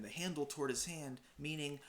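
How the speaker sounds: ordinary talk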